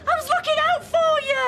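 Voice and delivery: very high, loud shout; angry protest. Acted. Defending interference to an angry Charlie, shrieked protest. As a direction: yell it in indignant self-defence. Heated quarrel after the fight.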